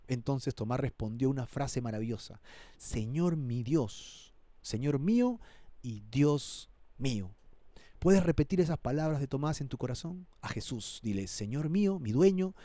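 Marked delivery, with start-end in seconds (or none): 2.8-7.3 slow, hushed awe; softened, worshipful delivery with weighty pauses; reverent confession. Acted. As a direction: say it slowly, as a heartfelt confession of faith. Acts out Thomas's awed confession.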